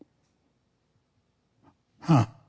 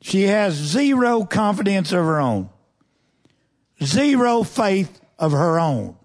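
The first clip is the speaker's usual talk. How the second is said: "zero" hit hard and high both times; stress on zero; 5 semitones higher than usual. Real, not acted. Preacher to congregation on the widow's faith, "zero" punched. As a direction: punch "zero", then fall away.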